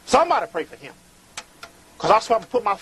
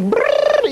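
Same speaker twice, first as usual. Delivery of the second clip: lip trill; 6 dB louder than usual. Acted. Playful trill mid-sentence.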